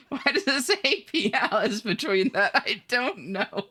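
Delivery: high-pitched, giggly confusion; helpless, amused protest; laughing bafflement. Real, not acted. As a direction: break up laughing as you ask.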